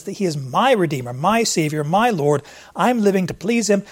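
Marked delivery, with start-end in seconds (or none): none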